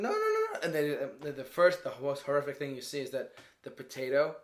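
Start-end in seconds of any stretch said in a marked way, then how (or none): 0.0-0.6 high squealed string of no's; rapid high-pitched mock pleading; mock panicked protest. Acting out the horrified food.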